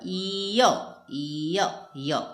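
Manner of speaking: ईय drawn out slowly, then य; sound blending demo. Shown as a demonstration. Teacher showing students how ई and अ merge, slowly. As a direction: glide ईय slowly into य. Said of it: "ई के बाद अ का उच्चारण करेंगे तो य बनता है".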